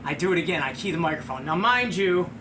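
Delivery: ordinary delivery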